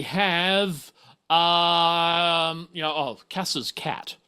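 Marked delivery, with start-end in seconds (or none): none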